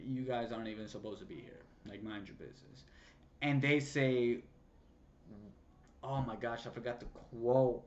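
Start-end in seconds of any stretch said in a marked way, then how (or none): 0.1-2.8 low, quick, muttered brush-off; understated irritation, soft and fast; retold annoyance. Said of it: "he gets kind of annoyed".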